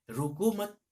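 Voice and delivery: high, loud call of रोको मत; poet's rallying cry; ringing cry. Shown as a demonstration. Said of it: "कवि कहते हैं कि".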